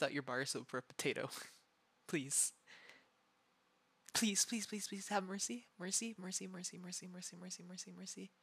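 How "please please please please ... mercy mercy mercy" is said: begging for mercy. Real, not acted. Begging for mercy while tickled.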